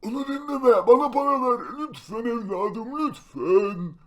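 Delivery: high-pitched pleading beggar; high begging old voice. Acted. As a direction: beg in a high, whiny old voice.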